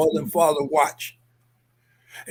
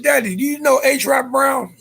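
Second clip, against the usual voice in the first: urgent retold questioning; 7 semitones higher than usual. Questioning father, rising urgency.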